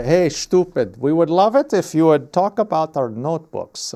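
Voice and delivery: loud, badly faked German accent; terrible German accent. Imagined German sponsor pitching the streamer, in a terrible German accent. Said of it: "that's a terrible German accent".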